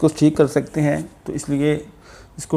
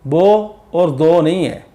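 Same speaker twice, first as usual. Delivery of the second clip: normal talking voice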